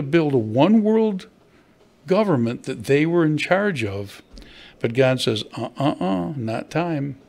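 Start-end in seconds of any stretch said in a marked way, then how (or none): none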